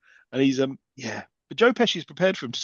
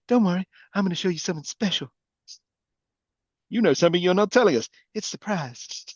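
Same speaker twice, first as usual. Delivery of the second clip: bad acting; 5 semitones higher than usual. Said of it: "it's aged badly".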